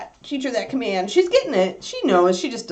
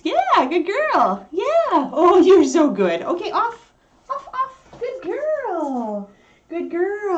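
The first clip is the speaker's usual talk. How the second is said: high, drawn-out praise for a good dog; doggy praise voice; 9 semitones higher, slower than usual. Real, not acted. Owner praising big puppy Kiki, high cooing voice.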